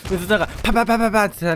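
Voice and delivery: quick pa-pa-pa of a spraying SMG; mock gunfire, fast and light; rapid gunfire imitation. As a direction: rattle it off fast like a machine gun.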